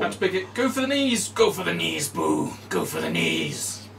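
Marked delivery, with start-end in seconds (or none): 0.5-1.3 high, loud cheering cry; high shout. Pretend. Same quote said two ways. 1.3-2.1 battle cry. Pretend. Game quote, cheering battle cry. 2.1-2.5 low, slow barbarian battle cry; Minsk. Retold or imitated. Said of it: "No, that's Minsk, the barbarian". 2.7-3.9 slow, low Minsk growl; low barbarian voice. Retold or imitated. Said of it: "No, that's Minsk".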